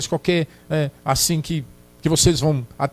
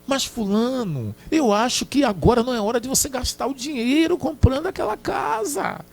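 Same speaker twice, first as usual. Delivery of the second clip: wife calmly objecting; 6 semitones higher than usual. Acted. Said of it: "calm discussion example".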